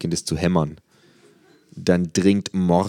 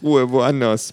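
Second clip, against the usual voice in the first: high, raised plea; pleading; 7 semitones higher, 5 dB louder, slower than usual. A narrator's reading. Patient to laughing visitors, high strained plea.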